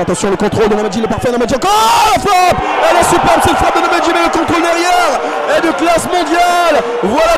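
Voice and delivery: rising into a goal call; soaring excited play-by-play; excited sports commentary. Real, not acted.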